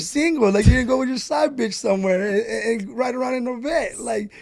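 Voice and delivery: an exasperated father setting his son straight; scolding dad. Retold or imitated. Dad telling his son he should've bought a truck, loud scolding. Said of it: "he's like".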